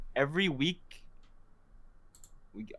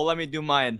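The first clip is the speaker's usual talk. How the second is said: usual speaking voice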